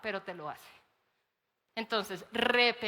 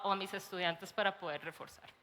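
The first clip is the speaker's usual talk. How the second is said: ordinary delivery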